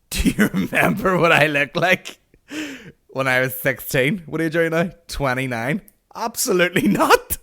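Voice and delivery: loud laughing disbelief, rising at the end; incredulous outrage. Retold or imitated. Comic mocking the schoolmate's question, incredulous outburst.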